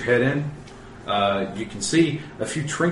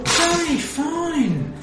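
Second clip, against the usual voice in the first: gnome voice; much slower than usual. Acted. GM plays the gnome.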